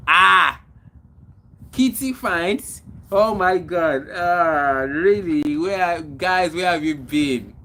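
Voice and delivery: loud, excited exclamations; real happy surprise, calling out loudly; delighted greeting. Real, not acted. Host welcomes a long-missed viewer to the live, delighted greeting.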